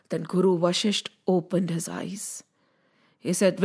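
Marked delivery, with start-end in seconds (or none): none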